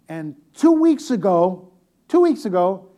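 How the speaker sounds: two weeks ago held out, loud and slow; slow loud build-up. Real, not acted. Preacher teasing the congregation with the joke, loud and drawn out. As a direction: stretch it out for the punchline.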